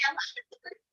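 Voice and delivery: breathy stage whisper. Acted. Girlfriend confiding her true match, whispered. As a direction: drop to a secret whisper. Sudden whisper mid-sentence.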